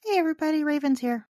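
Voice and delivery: bright, high, chipper greeting; customer service voice. Real, not acted. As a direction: sound like a phone support rep. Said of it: "what was that voice?".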